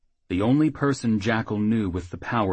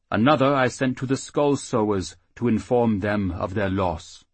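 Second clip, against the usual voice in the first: gentle halfling priest; 3 semitones higher, 4 dB louder than usual. Acted. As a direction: speak as the kindly little priest. Halfling priest speaks to half-orcs.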